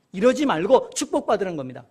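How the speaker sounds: usual speaking voice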